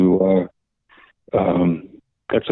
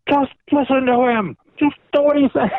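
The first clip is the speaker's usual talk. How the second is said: high-pitched, strained imitation of a man screaming; mock screaming. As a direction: mimic his top-of-the-lungs rant, pitched way up. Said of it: "He'd scream at the top of his lungs, obscenities".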